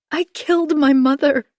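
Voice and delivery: high, loud anguished cry; loud confession. Acted. Confession repeated aloud to husband.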